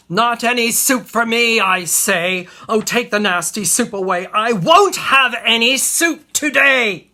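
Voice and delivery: full-volume tantrum, building to a shriek; high, roaring refusal; screaming at the top. Acted. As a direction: scream it as loud as you can. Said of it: "he screams as loud as he is able".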